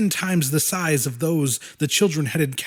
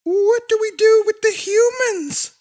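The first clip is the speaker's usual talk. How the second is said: high monster voice. A narrator's reading. Willix, a small monster, voiced.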